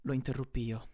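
usual speaking voice

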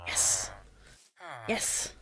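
hushed, very low celebration; soft, low and restrained; not shouting. Real, not acted. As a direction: keep the triumph down, almost under your breath. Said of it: "Stop shouting into the microphone".

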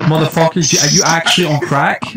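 loud, high scolding shout; exasperated yelling. Real, not acted. Streamer scolding friends over copyright, yelling in exasperation. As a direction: yell at them in disbelief.

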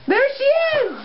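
high, excited play cry; excited sighting. Pretend. Spotting Jenica running through the streets, high excited cry. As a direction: call it out high, joining the chase. Spots Jenica in pretend chase.

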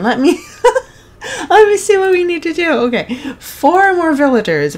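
ordinary talk

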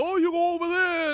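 high, loud put-on shouting over the crowd; hammed-up yell of a pushy bus hawker; mock yelling bus touts. Traveler mimics screaming bus staff, mock high yell. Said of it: "they start screaming at each other".

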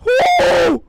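loud, high screamed "woo"; sudden piercing whoop mid-jump; high startled whoop. Real, not acted.

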